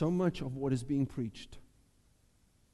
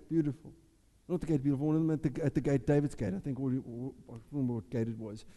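ordinary delivery

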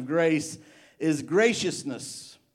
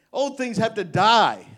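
higher and louder, driving the word die down; preaching shout; 7 semitones higher, 9 dB louder than usual. Acted. Preacher crying out to the church that old things must die. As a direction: proclaim it like a verdict.

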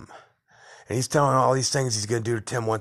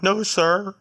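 mocking meek voice; 9 semitones higher, 5 dB louder than usual. Retold confrontation, cowed reply.

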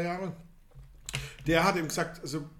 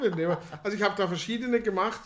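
normal talking voice

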